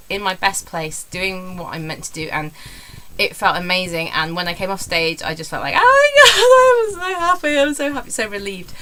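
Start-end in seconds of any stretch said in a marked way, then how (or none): 5.8-8.7 high, loud squeal of relief, easing off at the end; retold elation. Comedian reliving coming off stage, retold elation. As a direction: relive it with a long squealed burst, then settle.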